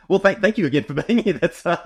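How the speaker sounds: high laughter spilling into words; laughing. Real, not acted. Host thanking the flu-struck guest, laughing high. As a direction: thank her while still laughing.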